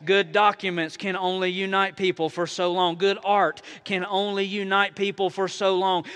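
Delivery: normal talking voice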